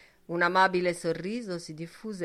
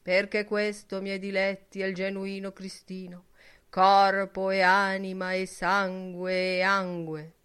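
preacher's tone; 2 semitones higher, 6 dB louder than usual. A narrator's reading. Mocking the congregation with a pulpit voice. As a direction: intone it like a priest at Mass. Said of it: "con un tono da predicatore".

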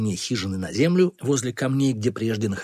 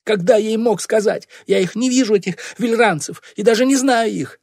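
high, loud self-defence; frantic protest; 11 semitones higher, 6 dB louder than usual. A narrator's reading. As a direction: plead innocence in a high, raised voice. Accused boy frantically denying. (отчаянное оправдание)

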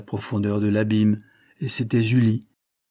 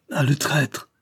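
higher, sharp outcry of betrayal; exclaimed; 5 semitones higher, slower than usual. A narrator's reading. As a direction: cry out at the traitor. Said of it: "s'écria-t-elle".

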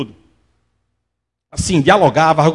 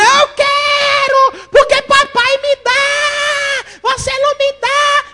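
high, slow whining child voice, mock shouted; whiny child; much slower than usual. Preacher imitating a spoiled boy wailing at his mother. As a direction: stretch it out like a spoiled kid wailing.

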